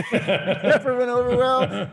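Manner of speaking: high, slow, laughing through it. Shown as a demonstration. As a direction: laugh as you repeat it.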